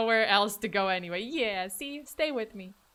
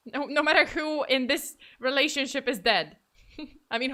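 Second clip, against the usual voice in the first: ordinary talk, nothing marked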